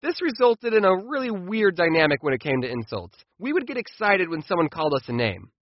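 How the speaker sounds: ordinary talk, nothing marked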